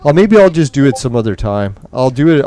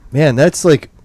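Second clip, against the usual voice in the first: ordinary delivery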